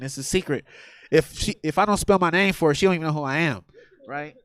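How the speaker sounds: ordinary talk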